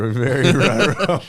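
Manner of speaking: slow, loud laughing ruh-roh. Real, not acted. Laughing as he admits big trouble to the host. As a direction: laugh it out slowly. Laughing at own arrest story.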